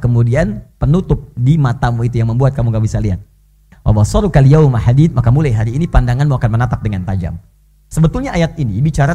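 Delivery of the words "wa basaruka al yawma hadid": Quran recitation. Acted.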